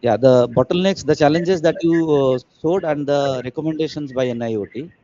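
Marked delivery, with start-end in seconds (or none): 0.0-3.4 raised voice to be heard clearly; louder. Real, not acted. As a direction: speak up over a bad line. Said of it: "Clear, sir".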